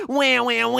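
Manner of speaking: mock crying. Streamer pretending to cry over a smaller win, mock wailing. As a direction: pretend to bawl like a baby.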